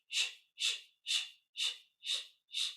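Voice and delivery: short unvoiced shh bursts from the belly; pulsed shh. Shown as a demonstration. Teacher showing a breath exercise, pulsed hissing shh. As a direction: push each shh out with the belly. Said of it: "we're gonna go shh".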